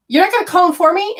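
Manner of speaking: high, quick, disbelieving question; incredulous protest. Retold or imitated. Stranded caller to operator, incredulous.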